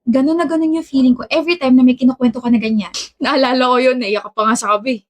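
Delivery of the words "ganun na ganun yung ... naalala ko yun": usual speaking voice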